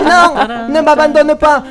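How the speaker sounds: high pleading cry. Acted. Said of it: "s'écria Virginie".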